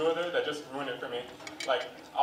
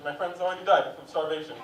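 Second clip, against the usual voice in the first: usual speaking voice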